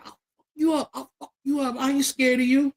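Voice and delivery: very high, squeaky mock threat; mocked little brother. Skinny brother threatens bigger brother, mocked high voice. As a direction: imitate a puny challenger in a high voice.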